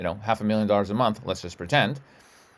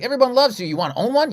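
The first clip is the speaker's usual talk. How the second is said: excited boss; 11 semitones higher, 5 dB louder than usual. Retold or imitated. Boss voiced in story.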